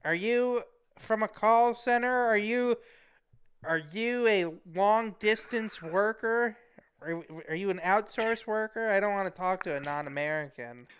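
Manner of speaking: high, deliberate mock interrogation; suspicious caller. Acted suspicious caller questions the worker.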